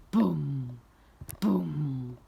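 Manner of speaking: low, resonant booms with pauses; thudding footsteps. A narrator's reading. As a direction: make each word a heavy giant footfall. Imitating giant's footsteps.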